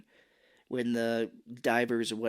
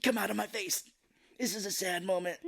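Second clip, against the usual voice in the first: willing tears out; 7 semitones higher than usual. Retold or imitated. Mimics actor willing himself to cry.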